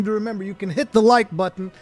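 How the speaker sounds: usual speaking voice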